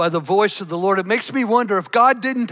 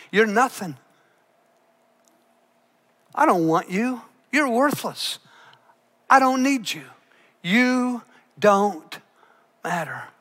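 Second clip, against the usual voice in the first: cold dismissive tone. Retold or imitated. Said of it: "in the tone of her voice".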